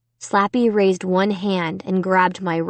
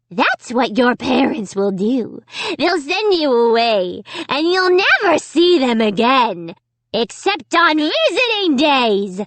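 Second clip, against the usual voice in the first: higher, rising gleeful taunt; taunting dummy; 5 semitones higher than usual. A narrator's reading. Dummy taunting Amy, higher and gleeful. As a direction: gloat over her fate.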